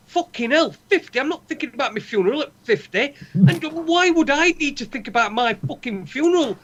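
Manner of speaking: high-pitched, exasperated outburst; worked-up comic outrage; indignant rant. Real, not acted. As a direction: rant in disbelief, voice leaping up. Comic rant about funeral plans.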